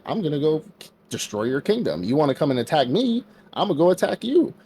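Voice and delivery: lightly acted tit-for-tat outrage; mock indignant threat. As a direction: pitch climbing high on the payback. Retold demon lord threatens revenge.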